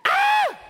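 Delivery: put-on high, loud shriek of panicked kids; retold kid scream. Father imitating his kids fleeing a bug, high shriek. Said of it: "they're screaming".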